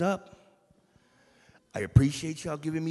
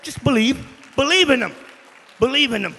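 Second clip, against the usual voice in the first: loud, high preaching cry; preaching at full force; 10 semitones higher, 14 dB louder, faster than usual. Real, not acted. Young preacher urging the church to believe, loud and high. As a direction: belt it out to the church.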